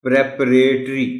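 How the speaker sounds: پریپریٹری as "prep-uh-RAY-tree"; loud, naive misreading "prep-RAY-tree" of پریپریٹری; mispronounced پریپریٹری. Shown as a demonstration. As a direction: read پریپریٹری wrongly, as spelt, with a long "ray". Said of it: "جس کو نہیں معلوم وہ اس کو پڑھے گا".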